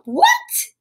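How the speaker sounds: very high squeaked exclamation; mock shock. Reader shocked by the goblin's order, mock shock. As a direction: squeal it in pretend disbelief.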